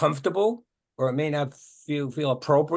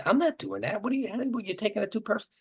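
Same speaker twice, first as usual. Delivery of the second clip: higher-pitched mock pushback; defensive protest; 6 semitones higher, 3 dB quieter than usual. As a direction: act out someone mildly indignant at feedback. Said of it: "the person goes".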